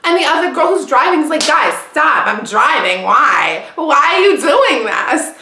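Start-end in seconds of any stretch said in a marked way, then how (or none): 1.5-5.3 imitated plea, loud with high peaks; exasperated driver. Driver to her fighting friends, exasperated plea. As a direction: plead as the fed-up driver, stretching the last question.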